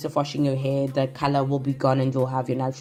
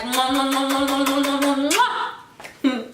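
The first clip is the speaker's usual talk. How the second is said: blown kisses; 8 semitones higher than usual. Real, not acted. Blowing kisses to viewers.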